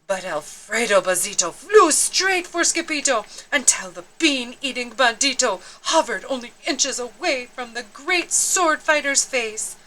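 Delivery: plain reading voice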